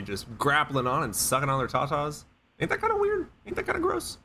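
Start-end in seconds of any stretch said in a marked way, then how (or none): 2.6-4.2 much higher, silly squeaky voice; squeaky mock voice. Mocking squeak while arguing about milk.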